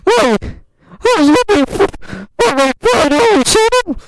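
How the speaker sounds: tremble through it, squeaking high; high loud scared girl voice; shaking with fear. Acted. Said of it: "Po was shaking like a leaf".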